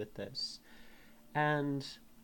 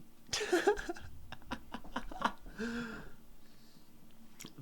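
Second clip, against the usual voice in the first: long breathy laugh; real laugh; 11 semitones higher, 4 dB quieter than usual. Real, not acted. Laughing at her own reading.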